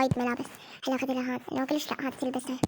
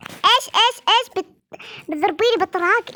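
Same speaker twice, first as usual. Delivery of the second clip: much higher, louder outraged "what"; outraged disbelief; 4 semitones higher, 4 dB louder than usual. Acted. Father reacts to mother's slap threat, outraged and high. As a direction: shriek back at the threat of a slap.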